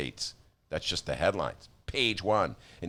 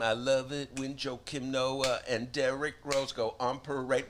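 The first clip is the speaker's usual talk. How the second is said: ordinary talk, nothing marked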